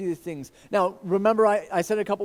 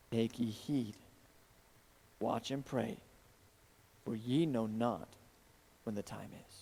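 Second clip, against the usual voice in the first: scripture reading; 3 semitones lower than usual. Acted. Pastor reads Jesus' warning to the congregation, hushed solemn reading.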